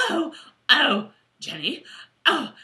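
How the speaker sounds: put-on high, jolly British accent; plummy British gushing, half laughing; Chummy. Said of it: "might be my best accent show".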